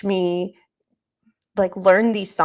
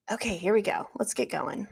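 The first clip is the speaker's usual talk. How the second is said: whispering; 8 dB quieter than usual. Real, not acted. Psyching self up to start.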